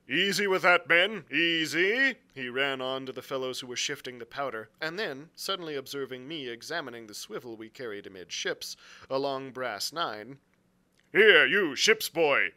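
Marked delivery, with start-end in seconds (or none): 0.1-2.2 loud, raised captain's call; calling out orders. A narrator's reading. Captain calls to men shifting powder. 11.1-12.5 loud, slow captain's shout; crying out. A narrator's reading. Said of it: "he cried".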